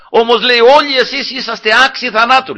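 high, emphatic retold rebuke; calling out. David rebukes Saul's sleeping guards, calling out. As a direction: call the accusation across a distance. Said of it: "Άρχισε να φωνάζει το στρατηγό".

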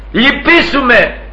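high, stretched-out cry for pity; acted plea. Preacher repeats blind man's cry for mercy, acted plea. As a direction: draw out the plea at high pitch. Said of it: "Αυτό εννοούσε".

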